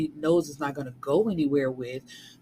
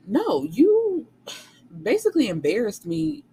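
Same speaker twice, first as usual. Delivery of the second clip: high, louder indignant comeback; indignant retort; 9 semitones higher, 3 dB louder than usual. Retold or imitated. Heroine confronting her gaslighting boyfriend, indignant and high. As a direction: throw the accusation back at him, pitched up.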